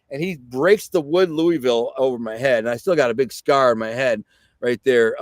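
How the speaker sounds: ordinary talk, nothing marked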